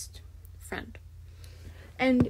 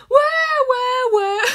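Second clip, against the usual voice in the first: high, loud mock wailing wah; hammed-up baby crying sounds; 11 semitones higher, 9 dB louder than usual. Mocking the characters' crying with fake wails. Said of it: "Just be like".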